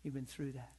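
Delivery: hushed, mock-consoling pat on the back; soft, syrupy pity for a brother; a touch of fake comfort; mock sympathy. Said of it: "patting each other and saying".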